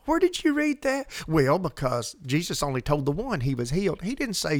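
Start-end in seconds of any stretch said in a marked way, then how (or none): 0.1-1.1 a sharp, much higher disbelieving question; incredulous retort. Pretend. Host mocking a listener's claim, with incredulous high pitch. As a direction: toss it back in disbelief, pitch jumping up.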